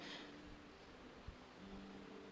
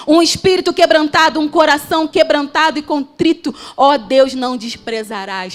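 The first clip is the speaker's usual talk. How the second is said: higher, loud, urgent sermon voice; fervent preaching; 5 semitones higher, 5 dB louder than usual. Acted. Preacher urging the church that God wants the heart, fervent and loud. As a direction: press the point home to the church.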